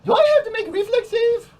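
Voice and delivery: put-on high, loud whining kid voice; whiny child. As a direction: whine it like a bratty child. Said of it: "Quigley sounded like a whiny child".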